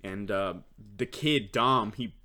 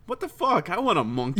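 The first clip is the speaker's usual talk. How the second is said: put-on excited kid; 9 semitones higher, 7 dB louder than usual. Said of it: "I was like".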